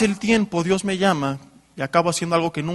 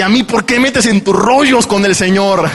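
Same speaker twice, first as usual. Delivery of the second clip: loud, higher mock wife's retort; wife protesting; 4 semitones higher, 11 dB louder than usual. Wife protesting being dragged into his deal with God, loud mock retort. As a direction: toss it back like an indignant wife.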